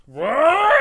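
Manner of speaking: loud startled creature cry; creature yelp. Acted. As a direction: shriek like a startled monster. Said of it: "It goes".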